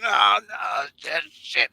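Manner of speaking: high mock screams of pain; retold screaming. Imitating a cramping friend screaming in pain. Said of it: "he's screaming like".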